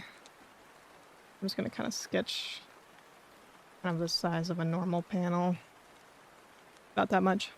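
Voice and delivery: soft whispered murmur while drawing; half-whispered, to herself. Real, not acted.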